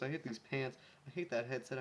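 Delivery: plain reading voice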